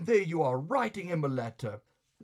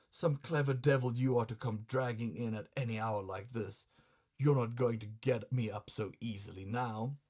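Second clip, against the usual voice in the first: ordinary delivery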